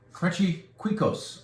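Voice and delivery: Cuicos said high and slow as KWEE-kos; naming the Spanish snack, slowly and unsure; unsure pronunciation. Shown as a demonstration. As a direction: try out the name Cuicos, unsure. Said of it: "I think that's how you say it".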